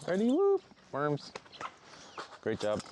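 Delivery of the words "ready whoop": high loud whoop; excited whoop. Real, not acted. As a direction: whoop them out happily. Releasing the chickens.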